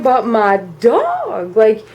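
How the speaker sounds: acted-out dismayed outrage; incredulous protest. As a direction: protest like a wronged kid, stretching the key words. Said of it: "And I'm thinking".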